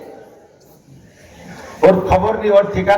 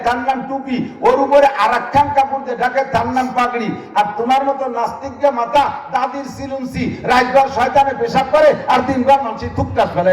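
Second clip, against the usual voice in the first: a higher, lightly voiced wife lecturing; wife's retort; 6 semitones higher than usual. Wife schooling her atheist husband, higher mock-lecturing tone. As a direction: list each head like a wife scoring her point.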